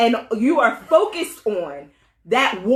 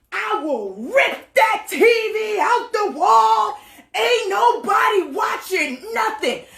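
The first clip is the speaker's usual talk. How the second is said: mock furious husband; 10 semitones higher, 3 dB louder, slower than usual. Husband threatening the TV.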